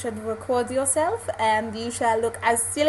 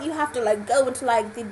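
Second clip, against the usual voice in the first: ordinary talk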